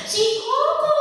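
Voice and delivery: put-on very high child's complaint; whiny child. Younger child tattling on older brother, very high. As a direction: tattle in a squeaky kid voice.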